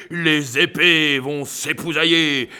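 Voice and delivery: ordinary talk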